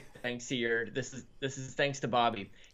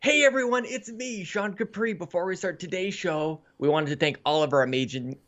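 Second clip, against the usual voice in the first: bright, high show-opener voice; upbeat host intro; 4 semitones higher than usual. Acted. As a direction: greet the audience like a host.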